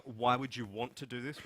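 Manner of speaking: ordinary talk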